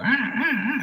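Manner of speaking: high mock crow caws. Imitating the crows outside, high cawing. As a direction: caw like a big crow, high. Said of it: "And they go".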